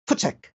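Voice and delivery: "Futsek" spat out with a sharp T S; "Futsek" said short and crisp, as if chasing off a dog; Afrikaans dog command. Shown as a demonstration. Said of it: "enunciate it as".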